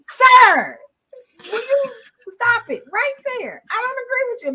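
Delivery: high, loud mock scolding; mock snapping. Retelling how she snaps at her friend, high loud mock scolding. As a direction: cut him off sharply, playfully.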